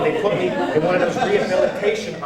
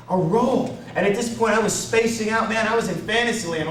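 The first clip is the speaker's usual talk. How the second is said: normal talking voice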